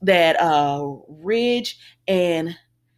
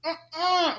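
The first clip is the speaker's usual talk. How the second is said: disapproving; 9 semitones higher, slower than usual. Retold or imitated. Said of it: "I said".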